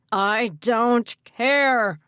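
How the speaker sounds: loud, very drawn-out roar; angry bellow. A narrator's reading. Mayor overrules the woman, furious bellow. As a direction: bellow each word slowly. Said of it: "Mayor Madsen bellowed".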